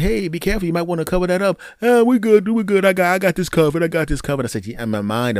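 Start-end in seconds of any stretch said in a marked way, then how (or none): 0.0-1.6 friendly warning. Retold or imitated. 1.8-4.4 higher, louder mock brush-off; mock the guy waving off advice; dismissive neighbor.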